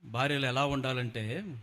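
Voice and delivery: normal talking voice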